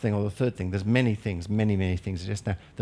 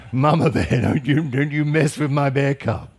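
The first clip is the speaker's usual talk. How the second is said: loud, forceful mama bear warning; protective mama bear; 3 semitones higher, 6 dB louder than usual. Acted. Preacher plays Mama Bear defending her cub, loud warning. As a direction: warn them off like a mother bear. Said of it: "behind him is Mama Bear".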